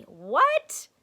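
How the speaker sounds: high, loud startled what; surprised what. Acted. Stink reacting to funny looks, surprised. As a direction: snap it out, caught off guard.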